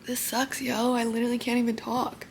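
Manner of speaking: soft, half-whispered talk through loose temporary teeth; keeping the voice down, mouth sore after surgery; hushed, barely talking. Real, not acted. Teeth falling out, can barely talk.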